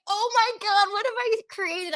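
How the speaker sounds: high-pitched mock horror; horrified. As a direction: re-enact dawning horror at what you caused. Retelling her horror.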